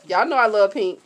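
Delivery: usual speaking voice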